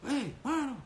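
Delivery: high-pitched mock-rude call; rude wife. Wife talking roughly to her husband, high mock rudeness. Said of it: "kalau ada perempuan ngomongnya kasar".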